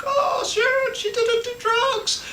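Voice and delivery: crying Roman. Retold or imitated. Retelling Roman after the murders, a high mock wailing cry. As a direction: imitate a man crying out the name of his wife. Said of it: "Roman comes out and he's crying".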